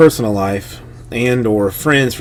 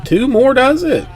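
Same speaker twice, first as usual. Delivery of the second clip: pitched way up, announcing two spots left; excited callout; 13 semitones higher, 3 dB louder than usual. Real, not acted. Host calling to viewers, pitched high and excited. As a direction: hype the viewers, two more does it.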